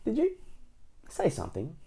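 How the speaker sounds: soft query, starting very high; pretending not to notice. A narrator's reading. Mister Bacon to shouting Mister Noisy, pretending not to notice. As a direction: ask lightly, as if you'd noticed nothing. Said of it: "pretended not to notice".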